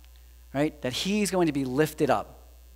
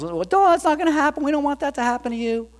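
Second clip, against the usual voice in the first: alarmed disciples; 10 semitones higher, 4 dB louder, faster than usual. Retold or imitated.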